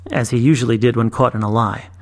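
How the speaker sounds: ordinary delivery, nothing marked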